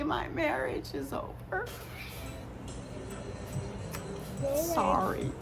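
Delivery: choked up, barely holding it together; blubbering. Real, not acted. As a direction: voice breaking into tears. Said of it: "Shouldn't be crying on my birthday".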